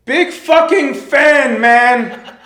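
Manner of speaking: slow, roaring fanboy shout; screaming fan yell. Retold or imitated. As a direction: bellow it like a crazed superfan in a tiny room. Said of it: "he screams it as loud as he can".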